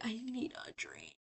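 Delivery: slow, very soft, drained mock plea; imitating someone used up; worn-out. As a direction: act exhausted, barely voicing it. Acts worn-out Angel at the bar.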